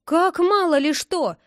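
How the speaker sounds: high, loud cry of outrage; indignant shout. A narrator's reading. Friend outraged by the boy's brush-off, shouting. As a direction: shout back in disbelief. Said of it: "закричал Лёня".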